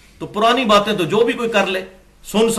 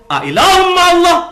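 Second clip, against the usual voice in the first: higher and louder Arabic verse; emphatic recitation; 12 semitones higher, 6 dB louder than usual. Real, not acted. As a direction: fling the verse out as a rebuke. Quoting verse as rebuke. (ज़ोर से ऊँची आवाज़ में आयत)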